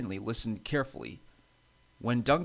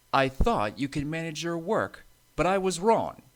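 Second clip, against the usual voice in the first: raised, higher scolding voice; stern; 6 semitones higher, 5 dB louder than usual. A narrator's reading. Thin controller to Stanley after repeated derailments, stern. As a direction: tell him off firmly. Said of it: "he said sternly".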